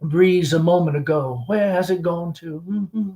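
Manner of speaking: lightly voiced chatty Southern belle mother; Southern mother hostess. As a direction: gush over the guest like a fading belle. Amanda hosting the gentleman caller.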